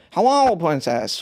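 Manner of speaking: lightly voiced princess greeting; slow, sing-song princess hello; cartoon princess. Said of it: "voice coming out, sorry".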